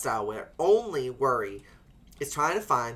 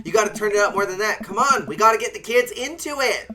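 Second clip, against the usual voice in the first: loud, higher, fast cheerleading; hyped up; 6 semitones higher, 6 dB louder than usual. Pretend. Urging the kids to dance, loud and hyped. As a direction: pump up the crowd.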